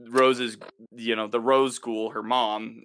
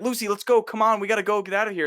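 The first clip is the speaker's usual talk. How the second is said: high, fast and louder; higher, louder, fast urging to leave; urgent hurry; 9 semitones higher, 4 dB louder, faster than usual. Retold or imitated. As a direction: hurry her out of danger. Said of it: "he's like".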